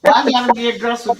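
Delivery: loud, fast, pushy challenge; mock aggressive. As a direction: bark it back playfully. Mock aggressive question, teasing.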